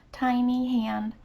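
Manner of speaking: ordinary delivery, nothing marked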